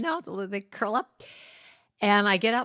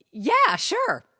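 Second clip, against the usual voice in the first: fake eager yes; 13 semitones higher, 8 dB louder than usual. Forced eager reply to agent.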